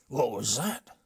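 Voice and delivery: loud threatening question; menacing challenge. Acted. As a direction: round on him sharply. Chief catches muttering.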